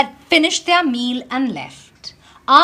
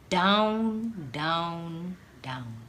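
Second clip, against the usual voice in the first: each down lower and softer until it fades; falling away; 7 semitones lower, 9 dB quieter, much slower than usual. A narrator's reading. Shoes tumbling from the window, fading lower and softer. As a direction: let the words drop like the falling shoes.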